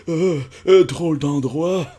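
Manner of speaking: ordinary talk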